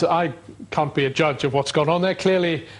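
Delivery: ordinary delivery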